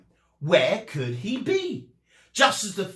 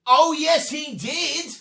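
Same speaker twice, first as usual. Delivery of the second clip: pantomime reply; 12 semitones higher, 5 dB louder than usual. Acted. Class's pantomime-style reply.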